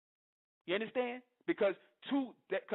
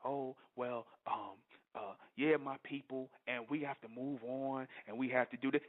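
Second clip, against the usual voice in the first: lower, softer and slow, a timid mock voice; lower, soft, slow mock timidity; mock meek preacher; 6 semitones lower, 4 dB quieter than usual. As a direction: parody a spineless speaker. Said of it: "and be like".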